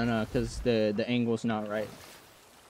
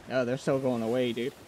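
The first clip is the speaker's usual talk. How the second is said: normal talking voice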